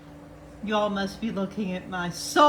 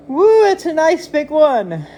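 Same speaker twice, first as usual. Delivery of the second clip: higher, much louder delighted whoop; thrilled whoop; 7 semitones higher, 11 dB louder than usual. Real, not acted. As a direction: cheer at the big spike. Thrilled at the big spike on the chart.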